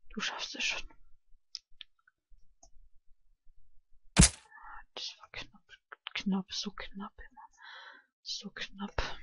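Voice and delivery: tense whisper; soft tense whisper. Real, not acted. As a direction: breathe the words under pressure. Whispering tensely during play.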